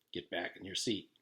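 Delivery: low, quiet order; stern stranger. A narrator's reading. The stranger ordering Jenny to sit, low and cold. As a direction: command her coolly.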